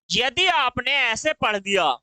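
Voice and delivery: ordinary talk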